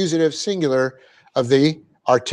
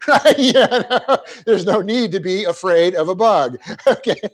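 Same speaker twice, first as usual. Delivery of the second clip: laughing; 4 semitones higher than usual. Real, not acted. Laughing at fear of bugs.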